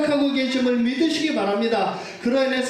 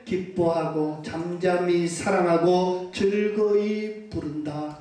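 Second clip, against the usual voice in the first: slow recitation; 5 semitones lower, much slower than usual. Acted. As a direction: draw each phrase out gently. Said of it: "그로 인해서 내가 어떻게". (느리고 부드러운 낭송)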